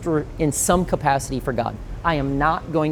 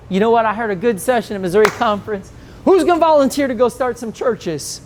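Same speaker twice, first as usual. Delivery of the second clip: higher and louder, hammed-up pulpit pitch; mock hype; 7 semitones higher, 4 dB louder than usual. Pastor mock-announcing volunteers on Sunday, higher and louder. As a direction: oversell it like a pushy recruiter. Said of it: "randomly get up this Sunday and go".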